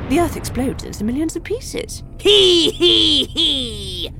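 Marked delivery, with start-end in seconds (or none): 2.2-4.1 high, loud villain cackle; evil laugh. A narrator's reading. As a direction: cackle wickedly. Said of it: "my evil laugh again".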